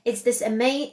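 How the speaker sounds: usual speaking voice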